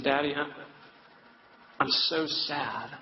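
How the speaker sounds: halting, slow retold sadness; tearful daughter, retold. Crying daughter confides in her father, mildly tearful. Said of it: "so through her tears".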